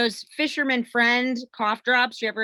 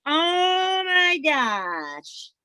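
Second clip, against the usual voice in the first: drawn-out dread; 6 semitones higher, much slower than usual. Real, not acted. About to eat worms.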